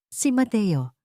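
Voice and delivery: usual speaking voice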